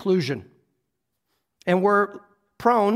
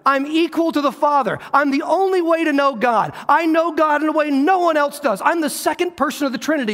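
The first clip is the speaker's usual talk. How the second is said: higher and louder, declaring divine authority; bold proclamation; 8 semitones higher, 4 dB louder than usual. Retold or imitated. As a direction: proclaim it with rising force. Jesus claiming equal to the Father.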